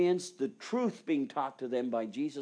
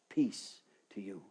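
gentle greeting; 8 semitones lower, 4 dB quieter than usual. Acted. Jesus greets frightened disciples.

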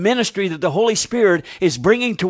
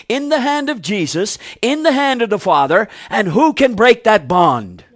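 building sermon delivery, louder toward the end; rising preaching; 4 semitones higher, 4 dB louder than usual. Acted. Preacher to his congregation about the Father's hand, building fervour.

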